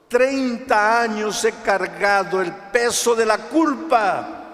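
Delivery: loud, high, drawn-out cry; clamor angustiado. Acted. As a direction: cry out under a crushing weight, slowly. Testimony cries out burden of guilt. (clamor angustiado, fuerte y alargado)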